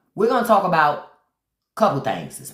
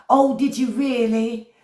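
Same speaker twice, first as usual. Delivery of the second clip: mock soap-opera reply; 5 semitones higher than usual. Mocking the fake text as a telenovela reply. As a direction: overact it like a bad soap line.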